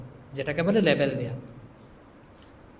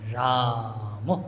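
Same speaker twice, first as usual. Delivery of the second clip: long low intoned name; 7 semitones lower, 4 dB quieter, much slower than usual. Shown as a demonstration.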